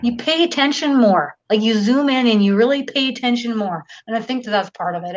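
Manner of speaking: ordinary delivery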